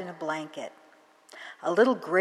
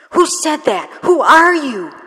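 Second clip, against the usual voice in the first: much higher, louder frightened calling out; startled shout; 10 semitones higher, 14 dB louder than usual. Acted. Girl calling out to an unseen voice, higher, louder startled shout. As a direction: shout into the dark to find who spoke. Beth calls out to stranger.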